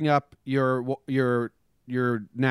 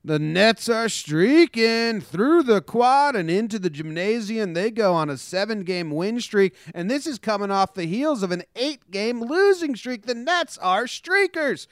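high, fast helium news read; sped-up sports update read; 9 semitones higher, 4 dB louder than usual. Acted.

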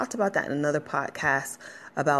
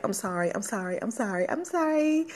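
put-on childish sorry that climbs from hushed to much higher; imitating a child's rising apology; little kid apologizing; 11 semitones higher than usual. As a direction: rattle it off like a small kid begging. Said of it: "when we're little kids".

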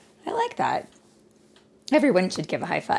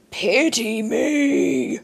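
higher, slow, pleading whine; whining giant; 8 semitones higher, 4 dB louder, much slower than usual. Acted. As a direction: beg pitifully like a sorry giant. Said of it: "whined the giant".

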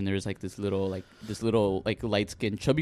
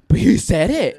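put-on high, loud kid protest; protesting little girl; 8 semitones higher, 8 dB louder than usual. Tattletale defending herself to coach, protesting kid voice. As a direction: imitate a child insisting.

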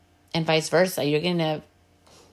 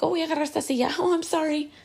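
high-pitched, flustered mock apology; over-apologetic; 11 semitones higher, faster than usual. Apologizing over a chair someone took, over-apologetic. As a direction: exaggerate a needless apology, pitched way up.

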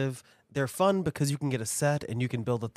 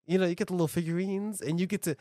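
ordinary talk

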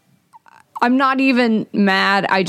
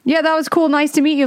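higher-pitched, lightly acted politeness; polite goodbye; 9 semitones higher than usual. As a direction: act out a courteous brush-off. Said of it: "I was polite".

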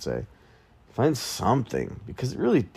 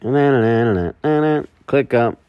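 higher, louder, drawn-out sung brand name; jingle ad voice; 5 semitones higher, 6 dB louder than usual. Pretend. Podcaster announcing his sponsor, hammed-up jingle. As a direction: belt it like a jingle. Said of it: "bring up my sponsor".

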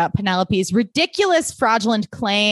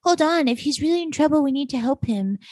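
Serena quote; 6 semitones higher than usual. Retold or imitated. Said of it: "And S is like".

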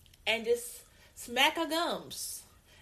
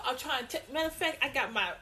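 normal talking voice

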